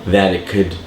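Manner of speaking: ordinary talk, nothing marked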